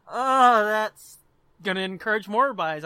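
usual speaking voice

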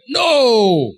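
high, long drawn-out no; reluctant objection. Acted. Hearer puts off changing their life, protesting. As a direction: stretch it out in acted protest. Hearer in preacher's story balks.

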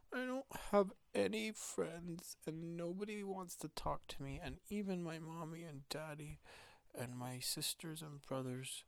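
put-on high, soft, forlorn child voice; sad little boy. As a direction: pour out loneliness in a small high voice.

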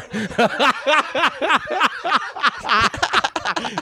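high burst of laughter. Real, not acted. Laughing at wrong answer.

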